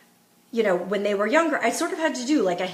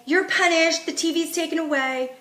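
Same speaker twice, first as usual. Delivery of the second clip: mock scolding; 7 semitones higher than usual. Shown as a demonstration. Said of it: "And I don't say".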